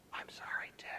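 hushed, contrite whisper; voiceless, softened reply; whispering back. Retold or imitated. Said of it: "Started to whisper back to me".

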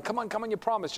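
high, insistent mock begging; acted pleading. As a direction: badger a brother, in retelling. Brother pleads again.

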